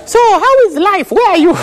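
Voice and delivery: high-pitched, over-effusive catch-up chat; fake friendly greeting. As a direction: gush like you've missed an old friend, insincerely. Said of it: "you start fake conversations".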